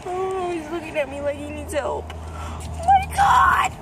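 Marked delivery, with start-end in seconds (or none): 2.1-3.7 shocked gasp. Real, not acted. Finding an injured bird.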